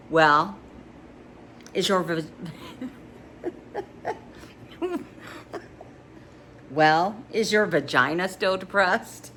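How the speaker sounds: a hint of a mocking neighbour, breaking into laughs; lightly voiced teasing friend. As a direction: tease her with a giggle in it. Friend Rennie teasing Sam.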